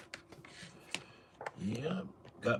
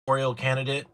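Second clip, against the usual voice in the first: usual speaking voice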